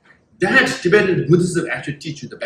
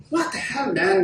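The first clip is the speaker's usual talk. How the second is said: baffled protester; 2 semitones higher, 5 dB quieter than usual. Acted. Baffled protesters going back complaining.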